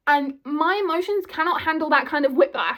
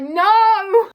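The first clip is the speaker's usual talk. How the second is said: sudden loud high cry of protest; loud, high, outraged shout; mock outraged no; 7 semitones higher, 10 dB louder than usual. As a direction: shout it like a fan refusing the idea. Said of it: "and it's like".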